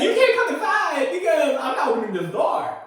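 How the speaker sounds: laughing imitation of a scared girl; laughing mock quote. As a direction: giggle while playing the panicked worker. Quoting the screaming student worker.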